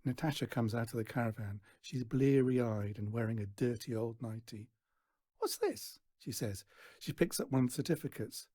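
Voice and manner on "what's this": put-on much higher, scornful teen voice; sneering girl. A narrator's reading. Daughter sneering at Stan's certificates, high scornful voice.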